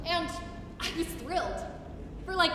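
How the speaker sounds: normal talking voice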